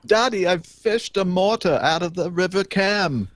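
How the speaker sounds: high, slow put-on English child accent. Host quoting the boy in a hammed-up English child accent. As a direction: play an excited English boy telling his dad in a high, slow voice. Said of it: "Great accent".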